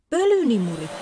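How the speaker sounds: high, loud shriek. A narrator's reading. Town mouse warning of the vacuum cleaner, loud high shriek. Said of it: "kirkaisi Saara".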